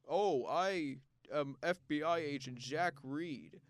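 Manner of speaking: slow, mock strained American accent with heavy S's; lightly lampoon a Swedish actor's stiff American accent; Dolph Lundgren's fake American accent. Reviewer mocks the lead actor's failed American accent, slow and stiff. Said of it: "He, he talks like this".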